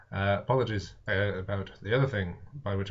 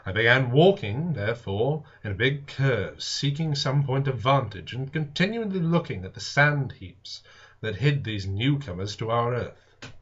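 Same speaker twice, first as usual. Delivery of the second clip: steady, raised reading voice; story narration; 3 semitones higher, 6 dB louder than usual. A narrator's reading. Reader narrating War of the Worlds to viewers, storytelling voice. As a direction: tell the tale to the listeners, evenly. Reads the novel aloud.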